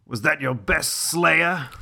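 slow, taunting Spike line; mock Spike. Spike taunts Buffy in a slow, lightly voiced taunt. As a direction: tease the Slayer, lightly voiced. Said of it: "I'm playing Spike".

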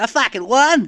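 high, loud stunned victory whoop; gleeful Aussie winner. Retold or imitated. Skater who won as everyone fell, loud and high-pitched. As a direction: crow over a lucky win. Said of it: "He's like".

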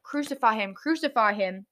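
usual speaking voice

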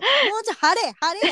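half-joking bark at a mumbler to project; mock yell. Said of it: "ってなってしまう".

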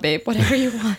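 a touch of doting sweetness; indulgent. Retold or imitated. Wife promising her post-surgery husband anything, lightly doting. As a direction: give in to him completely.

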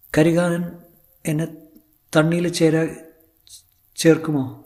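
high, halting plea; Sulekha. Acted. Actress pleading with Karikalan, high and hesitant. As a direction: lift it high, pausing between words.

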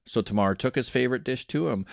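ordinary delivery

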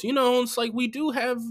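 high-pitched put-on voice; Julia Fox. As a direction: mimic her upbeat apartment-tour excuse. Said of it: "she, she goes".

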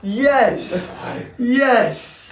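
loud, high joyful yell; excited cheer. Real, not acted. Excited cheering over an ounce.

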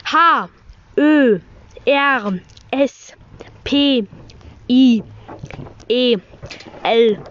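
slow letter-by-letter spelling. Shown as a demonstration. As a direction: spell each letter with pauses. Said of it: "Ich buchstabiere es".

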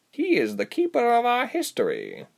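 high, deliberate creature voice; female creature. A narrator's reading. Creature deflecting Ilse's question, deliberate. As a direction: pronounce it with weight, pitched high.

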